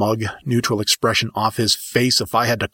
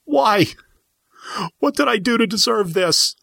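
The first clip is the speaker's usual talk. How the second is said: anguished wail; 13 semitones higher than usual. Acted. Pinned student crying out to sensei, high and anguished. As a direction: cry out in anguish. Continues wailing in pain.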